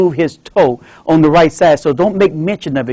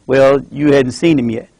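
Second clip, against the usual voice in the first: normal talking voice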